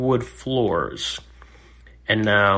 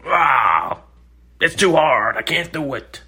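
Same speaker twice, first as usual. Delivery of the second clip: higher, louder mock whine; whiny complaint; 6 semitones higher, 5 dB louder than usual. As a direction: complain like giving up. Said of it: "you just wanna go".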